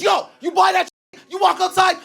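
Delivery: high mock hustler, pushed harder; a high, pushy street hustle; turned-up hustle. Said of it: "He goes".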